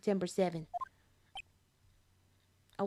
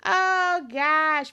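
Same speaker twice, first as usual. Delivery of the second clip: long, raised, loud groaned words; loud exasperation; 3 semitones higher, 8 dB louder than usual. Real, not acted. Player groaning at what befalls the lawyer, loud exasperation. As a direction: wail it out in exasperation. Reacting loudly to the game scene.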